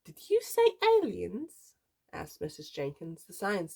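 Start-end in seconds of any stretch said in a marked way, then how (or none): 0.0-1.7 high, startled question; incredulous teacher's squeal; astonished question. A narrator's reading. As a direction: ask it in high-pitched disbelief. Teacher reacts to word aliens.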